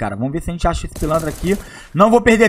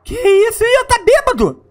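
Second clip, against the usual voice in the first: surprised. Real, not acted. Character suddenly acts drunk.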